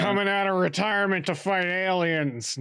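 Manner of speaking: high-pitched mock heroic announcement; mock game hero. Gamer mocking a bad sequel's hero, mock announcement. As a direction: play a hero absurdly announcing his comeback. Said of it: "Nate's like".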